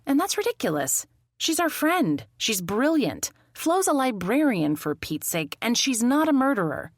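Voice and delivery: high-pitched, emphatic protest; indignant defence. Acted. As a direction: stand up for an accused friend, voice lifted. Indignant defence of her friend.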